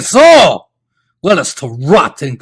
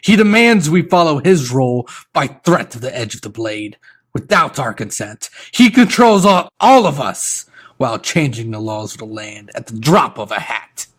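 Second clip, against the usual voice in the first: higher, loud accusing rebel; angry usurper; 7 semitones higher, 6 dB louder than usual. Acted. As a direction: rail against the king.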